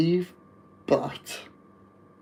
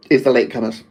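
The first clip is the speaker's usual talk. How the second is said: usual speaking voice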